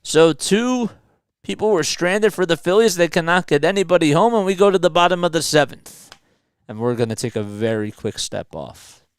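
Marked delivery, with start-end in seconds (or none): 0.0-6.1 excited, loud live baseball call; play-by-play. Acted. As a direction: call the inning like a broadcaster.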